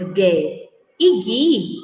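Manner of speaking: slow "gué e gui" with hard g and no U sound; "gué e gui" spaced out, the U left silent. Shown as a demonstration. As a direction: say "gué e gui" as "gheh" and "ghee", lingering on each. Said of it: "não pronunciamos o som da vogal U".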